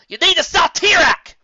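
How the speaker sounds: high, loud and slow; shouting. Acted. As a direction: shout the order urgently. Shouting order in battle.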